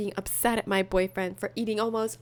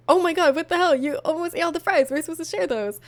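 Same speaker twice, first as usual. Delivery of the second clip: indignant outburst; 6 semitones higher, 5 dB louder than usual. Retold or imitated. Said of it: "I'd be like".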